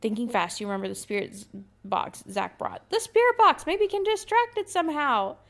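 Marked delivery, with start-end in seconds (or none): 2.9-5.4 high, loud sudden idea; excited shout. Acted. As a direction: blurt it out in a rush of hope.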